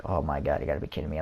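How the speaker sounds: low, soft muttered dismay; hushed, low-pitched disbelief; inner thought. Retold or imitated. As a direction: say it under your breath, appalled. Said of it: "I thought it in my mind".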